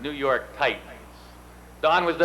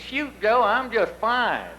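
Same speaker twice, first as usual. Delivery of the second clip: higher, slow imitation of a Texas teammate; imitating Don; 8 semitones higher, slower than usual. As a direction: lightly put on Texan voice, higher and slow. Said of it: "That's the way he talks".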